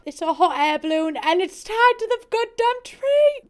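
loud, high-pitched burst of amazement; excited yelling. Real, not acted. Reviewer marvelling at the balloon on the build, excited yell. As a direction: yell it in rising disbelief.

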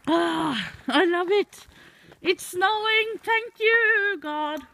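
high, loud elated cry; overjoyed. Real, not acted. Thanking God because it's snowing, overjoyed high cry. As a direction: shout thanks in sheer delight. Joy at snowfall.

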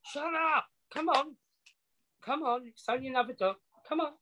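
high, soft coaxing to a pet; coaxing the dog. Real, not acted. To her barking dog, soothing it to quiet, high and soft. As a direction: hush the dog gently, pitched high.

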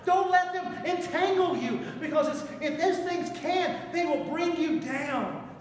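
loud, high, driving sermon climax; full-throated revival preaching; preaching at full force. Acted. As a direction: preach it hard to the rafters.

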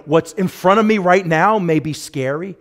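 ordinary delivery

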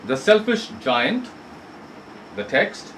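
normal talking voice

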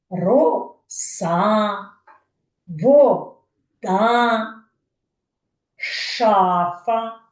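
роса, вода, шафа with long drawn-out syllables; each vowel of роса, вода, шафа stretched; sounded-out examples. Shown as a demonstration. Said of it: "підберіть інші слова".

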